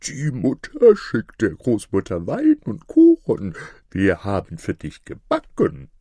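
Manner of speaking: wolf in a fake girl's voice; disguised voice. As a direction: keep up the little girl act through the door. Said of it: "mit verstellter Stimme".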